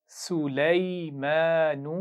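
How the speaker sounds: drawn-out, melodic tajweed recitation; slow Quran recitation. Shown as a demonstration. Teacher reciting slowly for pupils to repeat. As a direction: chant each syllable slowly for the class to copy. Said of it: "एक दफ़ा फिर पढ़ेंगे".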